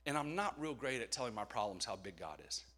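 ordinary talk, nothing marked

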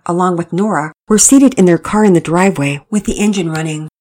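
ordinary delivery

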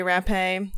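Rappe as rap-pay, ending in "ay"; alternate pronunciation. Shown as a demonstration. Host rejecting others' version of the name, Rappe pronounced "rap-pay". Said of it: "say it that way".

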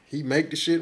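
ordinary talk, nothing marked

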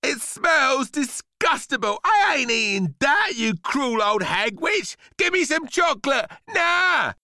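high, loud bratty tantrum; boy's outburst. A narrator's reading. Son refuses mum's grapefruit, shouting tantrum. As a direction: throw a shrieking kid's tantrum. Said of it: "hurt by her son's outburst".

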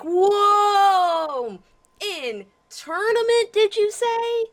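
high hammed-up disbelief; slow, stretched mock surprise; mock shock.

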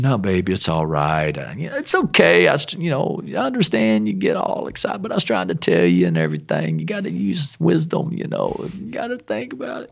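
putting on pious reassurance; mock spiritual consoling. Husband consoling wife after the sale, mock spiritual. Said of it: "now I'm being spiritual".

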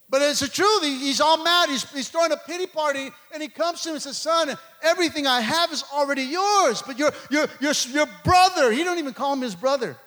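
high, driving preacher delivery; full-force preaching. Real, not acted. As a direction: press the story home to the congregation.

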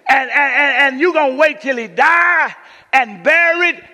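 loud, high-pitched challenge, acted out; preacher voicing a fed-up complaint at full volume; indignant Mary. Mary confronting Jesus for coming late, indignant. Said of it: "'Cause Mary was like".

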